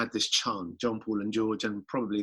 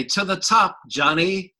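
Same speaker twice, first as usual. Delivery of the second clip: higher and louder hammed-up American accent; mock American accent; 8 semitones higher, 8 dB louder, slower than usual. Bandmates answering John, ironic American accent. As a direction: send up an American cheerleader. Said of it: "in an American accent".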